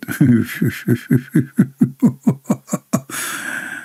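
a drawn-out soft chuckle; amused by the YouTubers' field day; long quiet laugh. Real, not acted. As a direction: keep chuckling softly.

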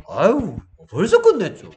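높고 크게 뽐내는 학생 흉내; 당연하다는 듯 과장해서 으스대기; 여유 부리는 모범생. Acted. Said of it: "약간 여유 있잖아". (여유 넘치게 목소리 높여 으스대기)

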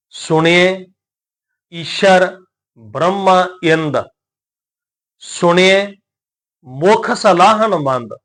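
slow, measured recitation of sacred verse; scripture recitation. Shown as a demonstration. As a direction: recite each word drawn out, with pauses.

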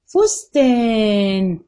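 فستان drawn out slowly, every sound clear; فستان spoken very slowly and clearly; slow demo word. Shown as a demonstration. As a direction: stretch فستان so pupils hear the final ن. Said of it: "حرف النون جاء فين؟".

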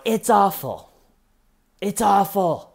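high wail, falling away; mock lament. Suspect reacts to the video on the laptop, mock wailing. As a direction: imitate an overdramatic high-pitched moan.

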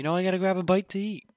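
hammed-up carefree kid; mock kid voice.

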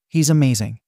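ordinary delivery

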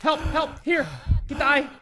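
ordinary talk, nothing marked

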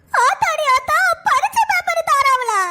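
high, panicky schoolgirl; scared schoolgirl voice. Acted. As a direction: squeak it out like a frightened pupil. Student voice among classmates. (பயந்த மாணவியின் உயர்ந்த குரல்)

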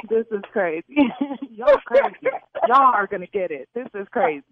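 breathless laughter between words; can't stop laughing. Real, not acted. Laughing after the prank reveal.

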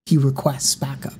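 ordinary delivery, nothing marked

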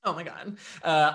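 ordinary talk

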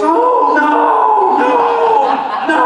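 high shouted no no no; a wife startled into shrieking; wife's alarmed cry. Retold or imitated. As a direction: cry out in horror at his face. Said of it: "She looks at me and goes".

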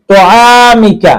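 তোয়ামিকা with a full, heavy ত, drawn out slowly; তোয়ামিকা, ত pronounced heavy and rounded. Shown as a demonstration. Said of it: "মোটা করে পড়তে হবে".

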